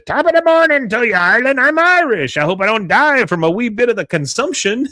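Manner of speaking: very high, loud hammed-up Irish brogue; drunken Irish brogue. Drunk leprechaun greeting the stadium, hammed-up Irish brogue. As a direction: play a pretend-drunk leprechaun with a broad Irish accent. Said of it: "in his Irish brogue".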